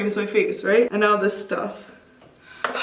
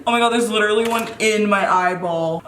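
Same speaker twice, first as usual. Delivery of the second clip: ordinary talk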